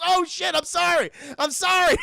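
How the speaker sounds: loud, high acted panic; hammed-up frantic regret; panicked apology. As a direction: blurt it like you just hurt a pet. Billy drops Gizmo, apologises.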